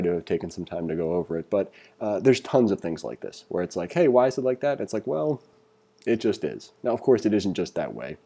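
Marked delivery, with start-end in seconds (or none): none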